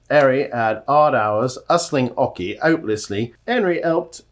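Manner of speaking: loud, hammed-up Cockney with no H's; broad, loud put-on Cockney; Cockney accent. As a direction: belt out the tongue-twister in mock Cockney. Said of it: "test of his Cockney accent".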